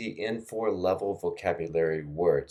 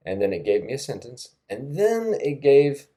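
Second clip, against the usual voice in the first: ordinary talk, nothing marked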